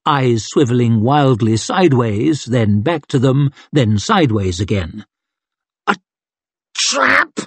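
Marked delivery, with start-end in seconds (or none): 5.9-7.4 very high, broken-up grunted warning; strained grunt. A narrator's reading. Trapped man repeats his warning, straining through clenched teeth. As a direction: split the words and squeeze the last one out high. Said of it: "he grunted through his clenched teeth".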